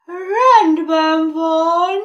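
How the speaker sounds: very slow, high, loud yawn. Acted. Horse agreeing while still sleepy, yawning. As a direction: stretch it through a yawn. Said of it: "újra nagyot ásítva".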